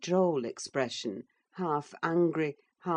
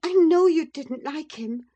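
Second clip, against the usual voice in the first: higher and louder, joy held barely in check; restrained delight; 11 semitones higher, 8 dB louder than usual. A narrator's reading. Catherine coaxes her husband, barely holding in delight. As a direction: keep the joy just under the lid. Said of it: "repressing a little the intensity of her delight".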